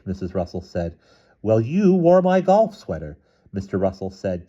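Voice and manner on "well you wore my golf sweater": high, slow, pointed retort; indignant accusation. A narrator's reading. Wife quarrelling with husband over clothes, indignant. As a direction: throw it back in a high voice.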